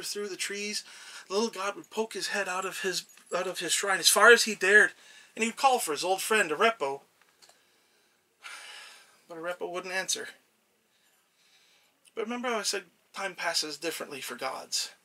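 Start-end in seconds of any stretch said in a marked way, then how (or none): none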